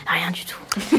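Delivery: soft whispered reply; whispering. Real, not acted. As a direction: whisper it back.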